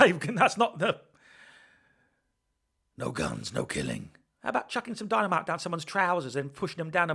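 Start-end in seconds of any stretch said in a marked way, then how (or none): 3.0-4.1 low Batman growl; deep Batman voice. Retold or imitated. Deep voice quoting Batman rules.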